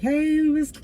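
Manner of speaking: high, drawn-out mock greeting; students greeting teacher. Kids greeting their returning teacher, sing-out hello. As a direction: lightly imitate excited pupils.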